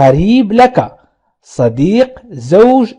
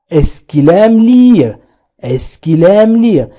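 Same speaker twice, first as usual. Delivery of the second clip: very slow, each syllable clear; slow teacher demo; slow model sentence; much slower than usual. Shown as a demonstration. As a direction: spell it out for learners. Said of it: "Est-ce que تعني هل".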